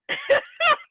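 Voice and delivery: shrill burst of laughter; high laugh. Real, not acted. Laughing at heart attack story.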